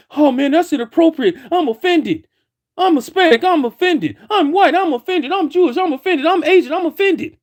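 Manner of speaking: high whiny mock-offended voice. Mocking other groups who say they're offended, high and whiny. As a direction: ham up the offended complainers, pitched high.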